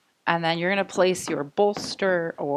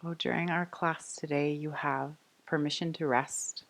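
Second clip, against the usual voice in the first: soft, unhurried meditation voice with long pauses; guided meditation; 6 dB quieter than usual. Real, not acted. Yoga teacher inviting the class to rest, calm guided meditation. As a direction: settle them gently into noticing the breath.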